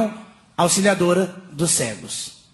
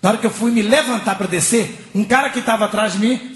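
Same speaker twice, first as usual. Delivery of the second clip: normal talking voice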